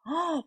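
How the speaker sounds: sharp re-enacted gasp; shocked gasp. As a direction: gasp as if startled by the news. 놀람을 재연하는 숨소리. (깜짝 놀라 헉 들이쉬는 숨)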